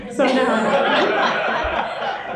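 high laughing through the words; can't stop giggling. Real, not acted. Telling the group about her overlong share, laughing.

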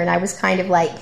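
ordinary talk

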